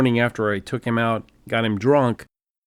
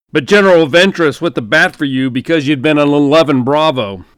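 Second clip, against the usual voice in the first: booming general; 3 semitones higher, 5 dB louder than usual. Acted. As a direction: boom it down the phone line like a hearty general. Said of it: "He continued booming into the phone".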